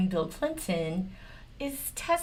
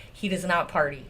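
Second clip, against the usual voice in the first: usual speaking voice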